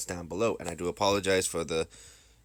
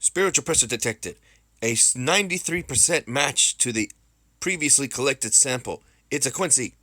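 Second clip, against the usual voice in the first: alert report; 6 semitones higher, 5 dB louder than usual. Acted. Acted detection report in story.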